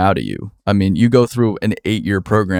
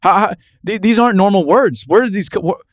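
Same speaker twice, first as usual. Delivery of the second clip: exasperated disbelief; 9 semitones higher than usual. Retold or imitated. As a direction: rant in disbelief at how lawyers talk. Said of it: "It's like".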